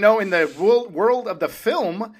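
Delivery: high, loud, worked-up argument; heated rant. Real, not acted. Film critic challenging co-host over the family blight, heated rant. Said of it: "[name] was ranting".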